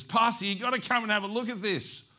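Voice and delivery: high, loud excited shout; urgent excited call. Retold or imitated. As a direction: call out urgently to come and see. Ma urgently calls pastor to look.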